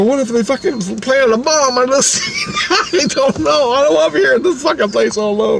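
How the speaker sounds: high-pitched fretful wail; retold fright with laughter creeping in; panicked whining. Scared of being left alone after threat, panicked whining.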